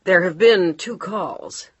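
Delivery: hesitant maid reporting slowly; housekeeper voice. Acted. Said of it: "Margaret trailed off".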